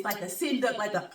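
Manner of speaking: usual speaking voice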